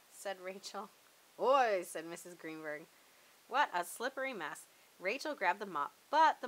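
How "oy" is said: put-on a loud exasperated oy from an old neighbour. A narrator's reading. Missus Greenberg to Rachel over the mess, loud oy. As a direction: throw out the oy, half-laughing dismay.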